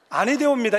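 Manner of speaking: 아니되옵니다 loud, high and formal; formal proper speech. Shown as a demonstration. Preacher showing what Peter should say: loud formal 아니되옵니다. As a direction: say 아니되옵니다 in stiff courtly Seoul speech. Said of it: "이래야 되는데".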